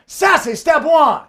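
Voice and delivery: high, loud, slow sassy call; sassy announcer. Pretend. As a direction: strut the words out with sass. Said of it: "The sassy way".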